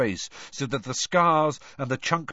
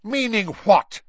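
high, loud snarling challenge; 11 semitones higher, 8 dB louder than usual. A narrator's reading. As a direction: snap the question back. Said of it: "snarled Snape".

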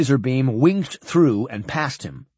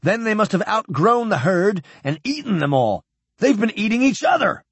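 raised, high-pitched exclamation; shouted; 7 semitones higher than usual. A narrator's reading. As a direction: carry on the outcry at a high pitch. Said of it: "Cargill shouted".

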